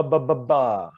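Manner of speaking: voiced "ba, ba, ba" bounced off the lips; consonant drill. Shown as a demonstration. Coach drilling voiced lip sounds, crisp. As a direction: bounce each "ba". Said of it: "inward a little bit with ba".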